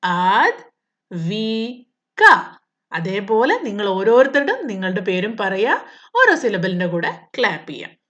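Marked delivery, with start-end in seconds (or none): 0.0-2.2 Advika said very slowly to claps; Advika drawn out in three slow beats; clapping syllables. Shown as a demonstration. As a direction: beat out Advika for a class. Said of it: "ഞാൻ മൂന്ന് പ്രാവശ്യം clap ചെയ്യണം".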